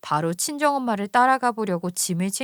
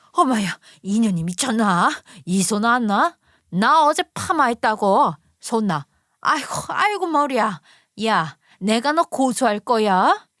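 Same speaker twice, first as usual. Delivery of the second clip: louder, outraged mother-in-law with her hair pulled; mother-in-law shrieking; 4 dB louder than usual. Acted. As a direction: wail and threaten while being yanked. 머리채 잡힌 시어머니의 비명. (머리채 잡혀 소리치는 시어머니, 격한 분노)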